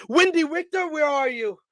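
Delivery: high sing-song calling out; old-timey diva call. Retold or imitated. Imitating Toni Storm calling out for a rival. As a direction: call out grandly for a long-gone rival. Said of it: "and Storm says".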